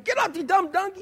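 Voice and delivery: high-pitched mock yell at the donkey; mock angry yell. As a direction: snap at the dumb donkey, hammed up. Balaam yelling at donkey.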